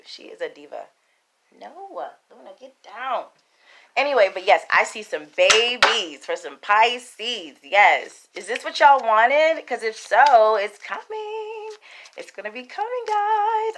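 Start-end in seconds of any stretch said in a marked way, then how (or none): 1.6-3.2 aside to cat. Real, not acted. Scolding cat off camera. 10.6-13.7 excited tease. Real, not acted. Promising Pisces viewers babies in a high sing-song.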